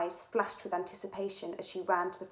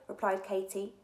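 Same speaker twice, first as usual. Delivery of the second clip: ordinary delivery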